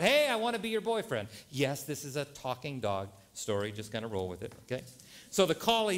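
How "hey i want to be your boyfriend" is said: high-pitched mock yell; eager yell. Three dogs call to a passing collie, playful yell. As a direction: call out eagerly across the park. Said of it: "they all yell out".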